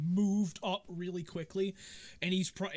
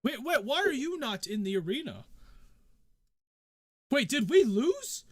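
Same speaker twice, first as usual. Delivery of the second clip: higher-pitched, startled questioning; puzzled nobleman catching on, lightly played; mildly acted bewilderment; 6 semitones higher, 6 dB louder than usual. Nobleman learns of the lost match.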